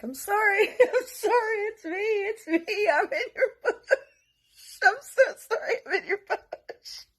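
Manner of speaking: high-pitched, laugh-choked pleading; helpless laughing apology. Retold or imitated. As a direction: re-enact apologising while cracking up. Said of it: "I couldn't help but laugh".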